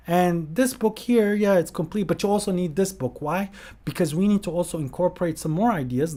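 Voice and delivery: high, insistent acted persuasion; mock sales pitch. Voicing people pushing an extra book.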